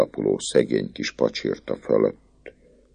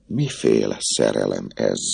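ordinary delivery, nothing marked

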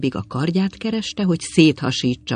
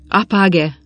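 sudden exclamation, higher and a touch louder; cried out; 5 semitones higher, 4 dB louder than usual. A narrator's reading. Pilgrim to the runaway nun, crying out. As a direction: call it out at the newcomer, mildly. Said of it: "A tisztes zarándok nyomban rákiáltotta".

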